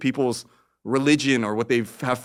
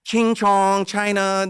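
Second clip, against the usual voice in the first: mocking taunt; 9 semitones higher, 4 dB louder, much slower than usual.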